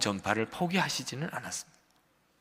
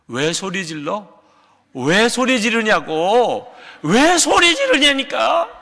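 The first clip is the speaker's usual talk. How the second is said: building from a raised challenge to a high, drawn-out shout; 우기는 말싸움 시범; 8 semitones higher, 8 dB louder, slower than usual. Shown as a demonstration. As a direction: argue stubbornly, louder and higher with each repeat. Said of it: "그 약점 하나만 계속 주장하라는". (점점 언성을 높여 우기며 따지듯)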